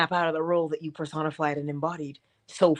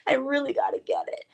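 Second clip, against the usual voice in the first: anxious wanting; 8 semitones higher, 4 dB quieter than usual. Retold or imitated. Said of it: "Moving yourself from wanting, which is".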